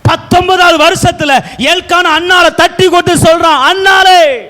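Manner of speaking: high, ringing sermon storytelling; full-force preaching. Acted. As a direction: call the quoted words out to the congregation.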